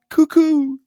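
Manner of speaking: high, loud sing-song call. Retold or imitated. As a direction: sing out cuckoo at the top of your voice. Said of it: "a figure pops out from behind the house and calls out".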